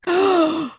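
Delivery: gasp. Real, not acted. Reacting to video of dog attack.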